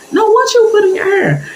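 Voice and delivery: high, curious church-lady question; pry about her hair; nosy church lady. Retold or imitated. The wife asks what is in the teller's hair, high and curious.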